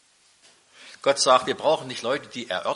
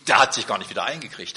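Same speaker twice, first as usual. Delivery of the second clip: ordinary delivery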